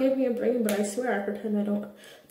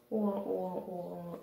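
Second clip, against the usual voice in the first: soft fading wah wah wah; mock sad trombone; 4 semitones lower, 12 dB quieter, much slower than usual. She marks her failure with a soft fading wah-wah. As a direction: hum a low deflating failure sound.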